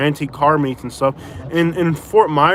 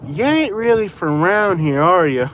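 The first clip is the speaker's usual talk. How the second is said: slow, higher local questioning the newcomer; imitated locals; 3 semitones higher than usual. Alabama locals quizzing a newcomer about his accent, imitated. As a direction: put on a drawling, doubtful local. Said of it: "the first thing people say is".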